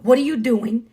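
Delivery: ordinary delivery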